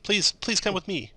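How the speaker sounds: a mother telling strangers goblins took her family, panicked; frantic mother. Acted. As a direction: rush the words out in fear, rising to a plea. Mother tells of goblin raid.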